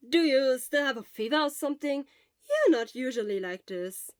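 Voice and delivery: loud, high amused surprise; half laughing, puzzled tease; surprised teasing. Acted. Obo teasing Shura about the hug, surprised.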